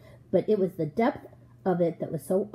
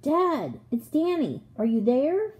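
raised, higher-pitched searching call; child's anxious call, moderately raised; 6 semitones higher, slower than usual. A narrator's reading. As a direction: call out for a missing father. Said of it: "I shouted".

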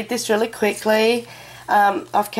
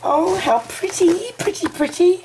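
delighted squeal; 11 semitones higher than usual. Real, not acted. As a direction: coo over it like a treasure. Unboxing a gift, delighted.

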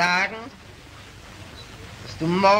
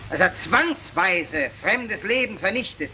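ordinary talk, nothing marked

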